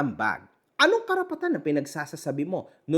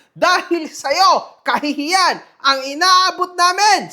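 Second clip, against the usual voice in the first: mock pastor; 8 semitones higher, 5 dB louder than usual. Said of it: "sabi ho yan ni Pastor Jam".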